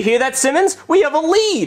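excited. Acted. Cop excited about lead.

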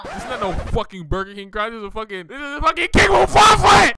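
put-on indignant African-accented king, building to a yell; mock royal outrage, exploding at the end; outraged shout. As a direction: build to a high, loud bellow of the royal name. Outraged king defends his crown.

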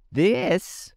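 a high, squeaky beast voice; squeaky Gump voice. A narrator's reading. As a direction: squeak it out like a strange creature. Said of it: "said the Gump in a squeaky voice".

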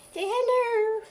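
high pet voice. Real, not acted. Speaking for dog to viewers.

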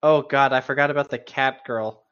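normal talking voice

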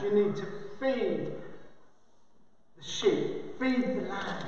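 quiet, earnest entreaty; soft solemn appeal. Acted. Solemn, softened appeal in Jesus' words.

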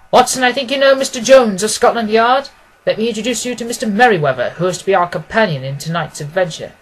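lightly voiced Holmes, cheerful and in charge; Holmes, brisk and cordial. A narrator's reading. Holmes to Watson, making introductions, brisk and cordial.

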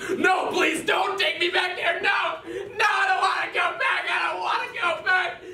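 very high, shouting desperate cries; panicked screaming. Acted. Comedian dragged offstage, screaming high in mock panic. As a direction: scream as you're hauled away.

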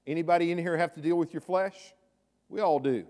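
ordinary delivery

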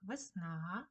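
весна with a long stressed last vowel; stress on final А. Shown as a demonstration. Teacher modelling word stress for children, весна stressed on final А. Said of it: "наголосна А".

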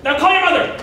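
acted lovers' quarrel at full tilt; exasperated fiancée. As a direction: argue back hotly, voice jumping up.

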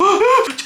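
high sudden gasp of fright; sharp frightened gasp. Real, not acted. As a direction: gasp as the monster strikes. (korkuyla nefesini tutma)

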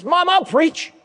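put-on high, eager boy voice. Boy volunteering to his mom, high eager voice. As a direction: pipe up like a keen twelve-year-old.

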